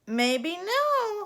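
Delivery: put-on a tough old gal telling her to shape up; scolding cowgirl godmother. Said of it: "said the fairy godmother".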